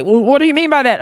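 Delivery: a high, loud put-on voice demanding an answer; mock questioner. Quoting someone questioning the guy, high mock voice.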